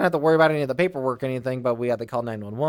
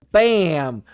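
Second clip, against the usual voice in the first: louder, punchy "Bam!"; card-game exclamation; 5 semitones higher, 8 dB louder than usual. Retold or imitated. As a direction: slam down the card with a shout. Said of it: "And I'd be like".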